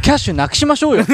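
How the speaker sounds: high, fast pushy pitch; eager shift leader. Pretend. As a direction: pitch the idea brightly and fast.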